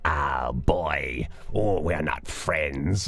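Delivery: slow, drawn-out creature voice; Robert Pattinson's heron. Retold or imitated. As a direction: put on a strange heron voice, dragging the words. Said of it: "and he's like".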